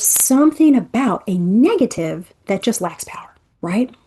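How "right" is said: ordinary delivery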